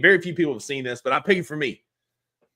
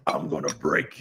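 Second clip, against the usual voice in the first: much lower menacing growl; mock deep threat; wrestler villain threat; 11 semitones lower, 4 dB quieter than usual. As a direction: threaten like a huge arm wrestler. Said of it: "He's like".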